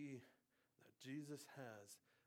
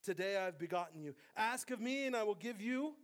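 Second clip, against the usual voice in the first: proclamation building louder and higher; declamatory scripture reading; 7 semitones higher, 11 dB louder than usual. Real, not acted. Preacher proclaims God's decree over his Son, building declamation.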